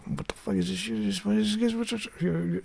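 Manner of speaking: a light take on a baffled Italian; mock Italian disgust. Shown as a demonstration. Acting out the Italian reaction, high and soft.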